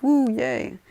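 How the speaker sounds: high, loud little whoop; mock cheer. Class allowed to use their quirks, mock cheering. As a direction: cheer like a delighted crowd.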